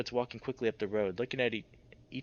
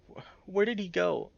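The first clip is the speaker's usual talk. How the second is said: tearful; 7 semitones higher than usual. Acted. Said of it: "Dev sobs".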